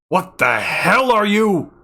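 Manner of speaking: loud, high, slow angry question; outraged shout. A narrator's reading. Doctor shouts at Kime in outrage, slow loud shout. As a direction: demand it furious and baffled.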